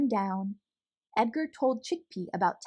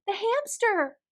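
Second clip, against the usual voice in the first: excited cry; 10 semitones higher than usual. Acted. Boy recognizing his lost hamster, excited cry. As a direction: cry out with a child's delight. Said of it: "the boy cried".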